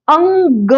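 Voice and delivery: अंग said with a nasal ng, no full ग at the end. Shown as a demonstration. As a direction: stop on अंग, don't add ग. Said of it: "ग करके नहीं पढ़ना". (अंग पर रुकें)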